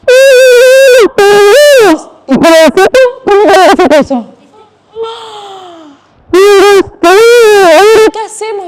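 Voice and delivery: loud, high, slow wail of a lost dad; hammed-up despair. Dad realizes family is lost, loud mock wail. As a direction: play up the panic, stretching it out.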